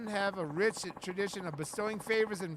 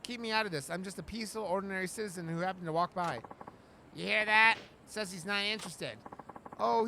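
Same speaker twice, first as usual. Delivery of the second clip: ordinary delivery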